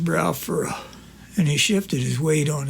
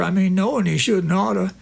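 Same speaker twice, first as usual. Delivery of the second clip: usual speaking voice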